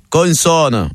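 put-on "Consonne" in Marseille accent, n sounded. Dédé following straight on, Marseille accent. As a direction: sound both n's in "Consonne", Marseille-style. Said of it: "Et Dédé, il enchaîne".

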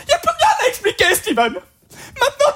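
very high, tearful dubbed heroine; mock crying. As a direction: wail it like a weeping film girlfriend. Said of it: "La fille pleure".

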